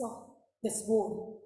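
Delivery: ordinary delivery, nothing marked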